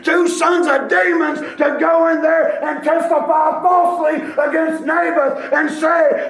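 high, loud, slow shouted preaching; full-force pulpit denunciation; fiery preaching. Acted. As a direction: thunder out the wickedness to the church.

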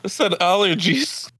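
high-pitched, insistent correction; insisting. Real, not acted. Insists on his word after teasing.

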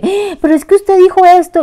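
high, loud prissy reproach; mock scolding. Churchgoers scolding her for a word, high and prissy. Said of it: "Porque a veces me dicen".

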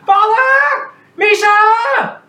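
very high, loud joyful cry; child shouts for father and brother; calling out. Acted. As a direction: call across the kitchen with joy. Child calls for family.

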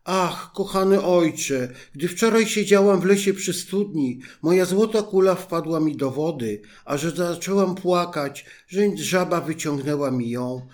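high, loud, urgent telling; anxious explanation. A narrator's reading. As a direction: pour it out anxiously to father. Princess anxiously explains to king.

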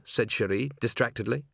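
ordinary delivery

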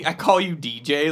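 normal talking voice

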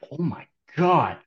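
smelling something awful. Real, not acted. Dog just farted nearby.

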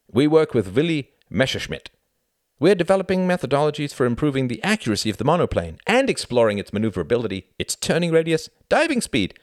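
high, loud technical patter; boastful pilot. A narrator's reading. Pilot telling Tom about their work, boastful. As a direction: rattle it off high and proudly.

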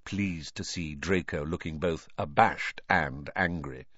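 usual speaking voice

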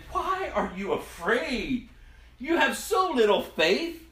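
Jesus calmly questioning his disciples; slow questioning. Acted. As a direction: ask it slowly, then press the point. Jesus answers the frightened disciples.

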